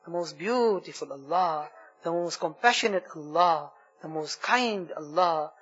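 fervent supplication, rising on each plea; heartfelt prayer. Acted. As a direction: pour out the dua to Allah, pleading. Leads an emotional dua.

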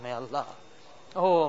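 ordinary delivery

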